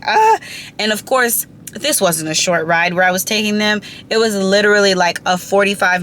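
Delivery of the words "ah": sudden high yelp of alarm; a jolted high cry, louder; panicked yelp. Retold or imitated. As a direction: yelp out in shock at the crash. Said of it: "And I'm just like".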